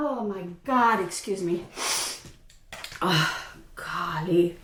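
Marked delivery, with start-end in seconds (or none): none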